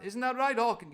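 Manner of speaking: loud high pirate voice; Long John voice. Acted. Long John fishing for Jim's agreement, loud and high. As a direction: boom it out like a jolly pirate cook.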